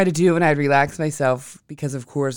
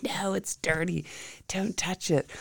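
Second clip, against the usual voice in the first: softer, slow mom warning; 5 semitones higher, 7 dB quieter, slower than usual. Retold or imitated. Mother telling son not to touch the bug, soft and slow. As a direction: gently scold the child. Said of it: "I'm like".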